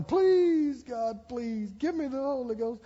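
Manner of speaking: high, drawn-out begging; hollered plea. Retold or imitated. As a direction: wail it to God in mock desperation. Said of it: "I just kept hollering".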